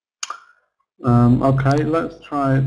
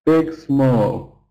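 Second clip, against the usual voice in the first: normal talking voice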